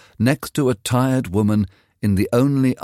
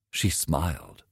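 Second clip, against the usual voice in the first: ordinary delivery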